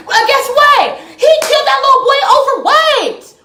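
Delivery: high-pitched, heated demand; worked-up, incredulous challenge; indignant outburst. Real, not acted. Indignant rant about the killing.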